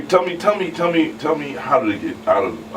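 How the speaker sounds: normal talking voice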